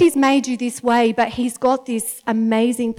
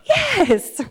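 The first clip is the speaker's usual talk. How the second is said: eager yes; 5 semitones higher than usual. Retold or imitated. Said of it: "He's like".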